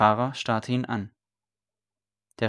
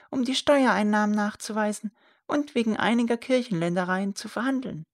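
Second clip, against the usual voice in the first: even higher, insistent on Peter; old woman repeating the name; 13 semitones higher than usual. A narrator's reading. Old woman repeating the name to her son, insistent. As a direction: repeat the name more emphatically.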